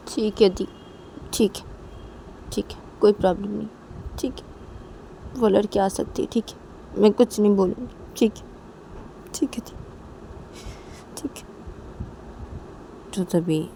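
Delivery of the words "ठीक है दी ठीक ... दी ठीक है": high, teary pleading, breaking into whispers; tearful younger sister. Acted. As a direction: sob out the agreement like a hurt little sister. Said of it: "रो मत शनाया". (रोती हुई धीमी, ऊँची आवाज़ में हामी)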